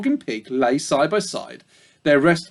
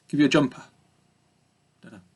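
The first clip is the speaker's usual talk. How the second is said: plain reading voice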